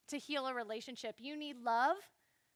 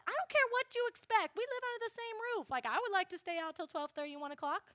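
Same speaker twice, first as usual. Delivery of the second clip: bratty comeback; 8 semitones higher than usual. Retold or imitated. Teen talking back to mom.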